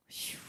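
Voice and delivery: long, soft airy "shoo"; imitated whoosh. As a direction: let out a long hushing whoosh. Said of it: "하면서". (길게 내는 슈 소리)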